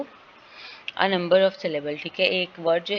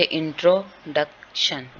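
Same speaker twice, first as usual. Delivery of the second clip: Introduction slowly broken into its syllables, a gap between each; 3 semitones lower, much slower than usual. Shown as a demonstration. Teacher showing the class a word has several syllables, introduction slowly split up. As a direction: say introduction in separate, drawn-out pieces. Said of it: "इसके syllable जो हैं वो एक से ज़्यादा हैं".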